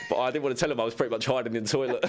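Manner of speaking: real laugh breaking in towards the end; laughing confession. Real, not acted. Player admitting he hid from the manager, laughing.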